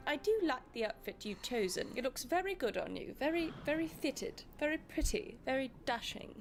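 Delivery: put-on proper upper-class British accent, Crown style; posh British accent. Greeting viewers like royalty, posh British accent. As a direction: play a grand royal host in British RP. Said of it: "I can't keep up that accent".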